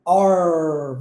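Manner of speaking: "R" with the tongue well curled back; low, curled-tongue American "R"; closed American R. Shown as a demonstration. Teacher showing how the tongue curls, closed American "R". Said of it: "a gente embola bem a língua".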